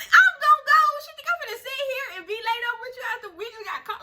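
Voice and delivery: high, loud, tearful outburst of disbelief; indignant crying yell. Retold or imitated. To the ex after getting caught, a tearful indignant yell. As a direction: wail it out, half crying, half outraged. Angry retelling of being caught.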